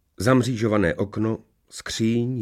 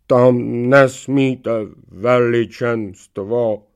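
very slow, loud, higher droning voice; headless knight protesting; 4 semitones higher, 7 dB louder, much slower than usual. A narrator's reading. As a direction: protest in long drawn-out syllables. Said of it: "marně protestovala hlava oklamaného rytíře". (táhlý protest rytířovy hlavy)

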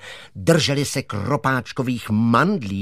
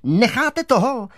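high-pitched, louder shout; indignant cry; 11 semitones higher, 4 dB louder than usual. A narrator's reading. Girl yelling at the germs to stop, shouted high. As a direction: cry out to make them stop. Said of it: "vykřikla".

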